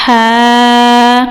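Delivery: ہا breathed from the middle of the throat; tajweed letter demo. Shown as a demonstration. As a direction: push ہا from mid-throat. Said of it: "یہ وسط حلق سے حرف ادا ہوگا". (ہا وسط حلق سے)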